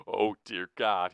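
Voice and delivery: loud, drawn-out cry of dread; yelped. A narrator's reading. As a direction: yelp in alarm. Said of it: "he yelped".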